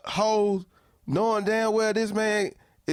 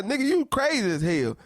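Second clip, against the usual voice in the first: ordinary delivery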